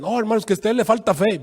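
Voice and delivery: a hint of a preachy reproach; mock preacher rebuke. Imita al pastor que reprocha. (un toque de pastor regañón)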